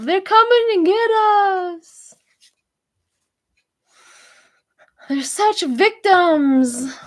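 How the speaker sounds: high, slow mocking whine; hammed-up parody of a whiny rant; mock whiny victim. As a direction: sneer it like a self-pitying victim. Mocking Don Junior's rant.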